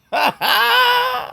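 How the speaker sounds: high laugh of disbelief at the price; disbelieving laugh. Real, not acted.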